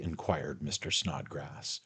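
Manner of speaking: plain reading voice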